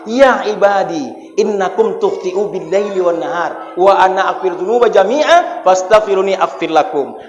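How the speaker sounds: solemn recitation. Acted. Reciting Arabic hadith of Allah. (bacaan hadis pelan dan khusyuk)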